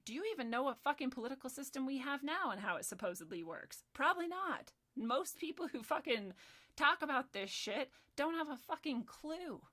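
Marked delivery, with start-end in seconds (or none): none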